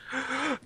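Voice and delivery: high, soft gasp of joy; imitated delighted intake of breath; joyful gasp. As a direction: gasp in mock wonder. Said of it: "the joy on their face".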